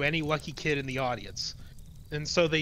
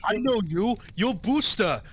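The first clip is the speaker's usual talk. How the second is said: raised, louder character voice; lightly acted recognition; 5 semitones higher, 3 dB louder than usual. Man recognising Booster, lightly acted raised voice. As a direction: call it out as a mild put-on character.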